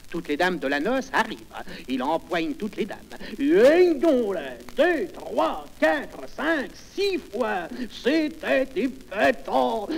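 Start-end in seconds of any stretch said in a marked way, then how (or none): 3.4-9.9 high, hammed-up counting to a stretched climax; frénésie comique. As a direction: count excitedly up to a drawn-out cry. Refrain joué avec toutes les dames. (comptage exalté, aigu et surjoué)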